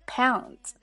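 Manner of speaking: "Pounds" with a round, full "ow"; full diphthong. Shown as a demonstration. Teacher models the full vowel glide in "Pounds". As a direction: open the mouth fully on "Pounds". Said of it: "双元音L的口型饱满度".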